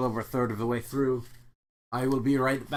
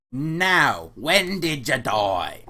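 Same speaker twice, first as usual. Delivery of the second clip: slow, louder British clerk; British accent; 6 dB louder, much slower than usual. Acted. Clerk repeating his question slowly, British accent. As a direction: ask slowly and clearly, British.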